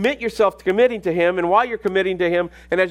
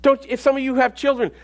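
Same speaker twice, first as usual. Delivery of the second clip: full-force preaching; 5 semitones higher than usual. Real, not acted.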